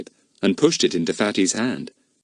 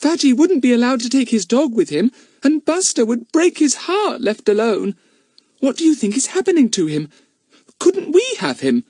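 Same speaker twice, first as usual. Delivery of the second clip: put-on high, worried little girl; 4 dB louder than usual. A narrator's reading. Bets begging the others to keep Buster, high and anxious. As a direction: plead like a small anxious girl.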